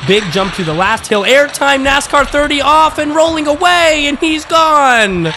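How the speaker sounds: high, loud race call, stretching the final word; live race play-by-play. Acted. Commentator calling a car racing away, excited high play-by-play.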